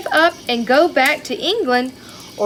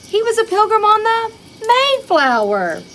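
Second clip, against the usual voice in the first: high, loud and bright announcement; excited reveal; 12 semitones higher, 5 dB louder than usual. Acted. Teacher to her class, an excited high reveal of the ship. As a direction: proudly reveal the ship's name.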